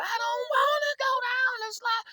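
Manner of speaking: high, drawn-out wail; a terrified kid begging at the top of the slide; crying brother. Retold or imitated. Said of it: "my brother crying all the way".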